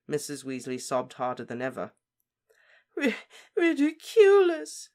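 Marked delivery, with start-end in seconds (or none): 3.0-4.9 loud, high broken sob; sobbing. Acted. Mother sobs again at a new body, loud and high. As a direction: stammer the word through tears, loud and high. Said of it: "she sobbed again".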